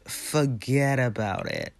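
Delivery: slow, mock Italian-American "Forget about it"; lightly hammed Italian accent, drawn out. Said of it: "I won't use my Italian accent".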